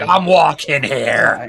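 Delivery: loud, drawn-out mock New Yorker; New York accent. Game master mimics the player's accent, loud New York caricature. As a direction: belt it out as an exaggerated New Yorker. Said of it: "you're like".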